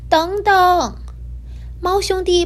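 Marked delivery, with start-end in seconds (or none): 0.1-1.0 high, louder plea to wait; calling out. A narrator's reading. Little cloud calling after the fleeing cats. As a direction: call after them not to go.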